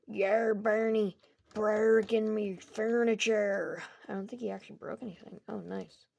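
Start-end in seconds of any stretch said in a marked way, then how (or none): 0.1-3.8 loud, slow, drawn-out pirate voice; Foxy. Acted. Foxy to Bonnie about breaking furniture, pirate voice. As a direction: growl it like a cartoon pirate, stretching the words. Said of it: "Foxy's like".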